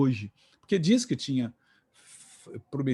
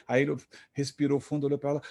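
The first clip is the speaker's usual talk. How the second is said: normal talking voice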